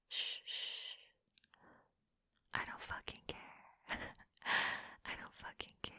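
hushing soothing whisper. Acted. Comforting him mockingly, hushed whisper. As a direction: shush him tenderly, barely audible.

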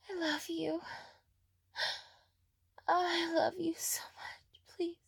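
usual speaking voice

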